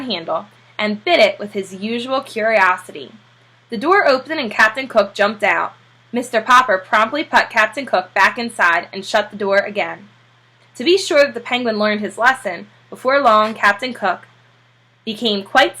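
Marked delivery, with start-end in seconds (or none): none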